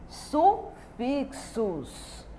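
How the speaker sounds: "sufixos" stretched out with a gap after "su"; syllable by syllable. Shown as a demonstration. Teacher announces the next topic to pupils, syllable by syllable. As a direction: break "sufixos" slowly into pieces.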